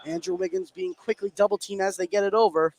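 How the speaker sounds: live basketball call, building as the shot goes up; play-by-play. Real, not acted. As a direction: call the action as it happens.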